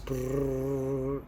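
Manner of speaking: soft rolled brrr, whirring along; quiet sputtering fast-forward noise; sped-up noise. Retold or imitated. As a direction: imitate footage racing by in fast-forward. Imitating sped-up countdown footage.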